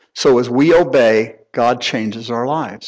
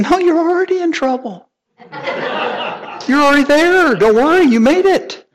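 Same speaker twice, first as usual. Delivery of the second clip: mock high-pitched teasing voice with a laugh, louder; playfully mock the boy in a much higher voice; teasing dad; 3 dB louder than usual. Father teasing crying son.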